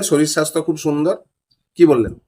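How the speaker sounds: ordinary talk, nothing marked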